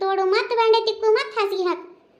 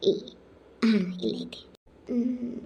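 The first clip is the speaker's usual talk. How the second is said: whisper; 5 semitones lower, 5 dB quieter, slower than usual. Acted. Finding the phone quietly.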